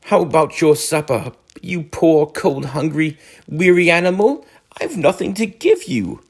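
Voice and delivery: high, whimpering moan. A narrator's reading. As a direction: moan it pitifully. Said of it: "he moaned".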